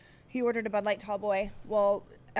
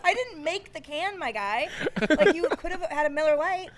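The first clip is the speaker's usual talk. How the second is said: high, loud, laughing comeback; laugh-shout back at the rude customer; incredulous laughing retort; 9 semitones higher, 8 dB louder than usual. Retold or imitated. Bartender firing back at a rude customer, loud and laughing. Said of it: "And I'm like".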